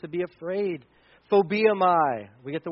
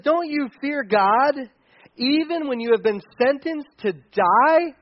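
higher, slowed reproachful challenge; protesting rebuke; 7 semitones higher, 3 dB louder, slower than usual. Retold or imitated. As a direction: protest it slowly, pitched up, as a rebuke. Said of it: "But the other criminal protested".

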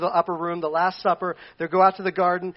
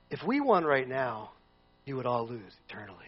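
softer, lower, intimate; earnest; 6 semitones lower, 8 dB quieter than usual. Retold or imitated. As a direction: warn them gently. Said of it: "Jesus is saying".

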